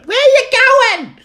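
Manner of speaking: shrieked falsetto wife, drawn out; nagging wife. Acted. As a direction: screech it high and loud as the wife. Mimics nagging wife calling out.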